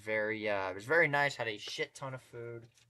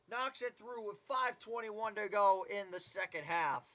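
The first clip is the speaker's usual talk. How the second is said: brisk, rising basketball call; live play-by-play; 7 semitones higher, 7 dB louder than usual. Acted. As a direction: call the game as it unfolds.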